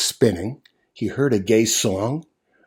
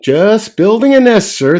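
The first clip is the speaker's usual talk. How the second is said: higher, louder cheery bird answer; chirpy bird; 10 semitones higher, 7 dB louder than usual. Acted. Bird answering the moose, higher and louder. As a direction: chirp back breezily.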